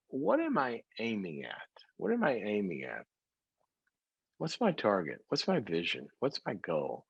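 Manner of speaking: quietly turning the questions over; introspective, hushed questions; soft self-questioning. Shown as a demonstration. Musing self-questions, softly.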